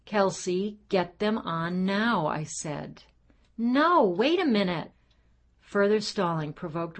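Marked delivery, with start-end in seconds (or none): 0.1-2.3 put-on slow, pressing command to a stalling child; father insisting the toddler put on her shoes, slow and firm; firm repeated order. 3.6-4.9 put-on high, loud childish protest; little girl refusing her shoes, high and loud; whiny child stalling.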